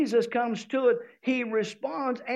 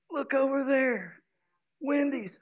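tempting voice; 3 semitones higher than usual. Acted. Body voiced pointing at food.

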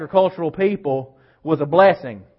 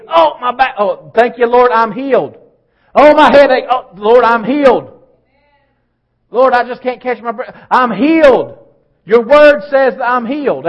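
loud, higher, fervent cries of healing; fervent loud confession; 6 semitones higher, 9 dB louder than usual. Acted. Believer in pain loudly declaring healing to the Lord, fervent. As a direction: proclaim healing over every pain. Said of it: "all my confession's gonna be".

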